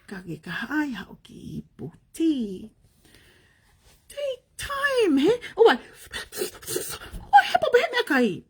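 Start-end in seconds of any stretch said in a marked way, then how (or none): 4.1-8.4 crying. Real, not acted. Tearful plea about being hurt.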